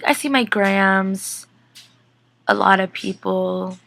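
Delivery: slow, soft, far-off Bonnie voice; drawn out and hushed, as if seeing ghosts; slow hushed seer. Retold or imitated. Bonnie describing the spirits she sees.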